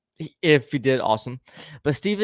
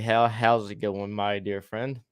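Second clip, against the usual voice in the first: ordinary talk, nothing marked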